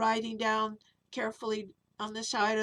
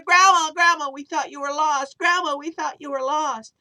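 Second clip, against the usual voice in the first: put-on relieved children; 7 semitones higher, 13 dB louder than usual. Kids hugging found grandma.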